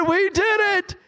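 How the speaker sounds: high, climbing jubilant cry; retold excited yell. Father to his wife at daughter's graduation, retold excited yell. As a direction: build up to a triumphant yell. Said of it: "I started yelling and screaming".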